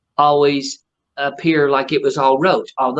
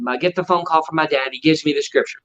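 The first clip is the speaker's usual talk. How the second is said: normal talking voice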